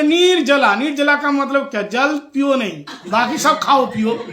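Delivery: loud, high, insistent comic explaining; emphatic self-justification. Retold or imitated. Old lady justifies her waterless fast to the speaker, loudly emphatic. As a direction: lay down the rule with mock authority.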